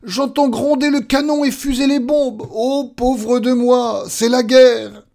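high, loud cries of terror; panicked wailing scream. Acted. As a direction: wail as if war broke out. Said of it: "hurla-t-il". (hurlements affolés, aigus et forts)

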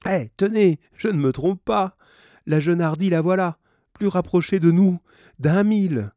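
high, loud triumphant announcement; excited sighting. A narrator's reading. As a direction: call the crew to look at what is spotted. Sights the ship, shows the crew.

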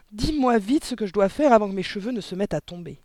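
panicky lament. A narrator's reading. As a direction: beg urgently for help. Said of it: "se lamenta le père". (supplication affolée, voix haussée)

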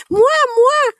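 very high, loud, excited squeal; eager cry. A narrator's reading. Child volunteering for the canteen, eager high cry. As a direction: pipe up to be picked first. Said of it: "s'écria Pauline".

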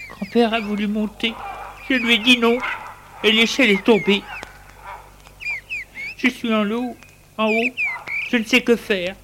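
put-on high child voice, whining; upset boy lying to grandmother; child's excuse. A narrator's reading. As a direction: play the innocent boy. Boy claims innocence after fall.

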